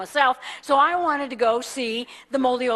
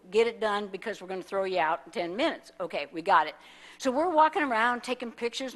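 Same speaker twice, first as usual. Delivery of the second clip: ordinary delivery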